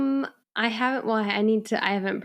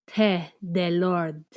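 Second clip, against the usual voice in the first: French accent; slower than usual. Shown as a demonstration. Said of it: "I could have done that in French".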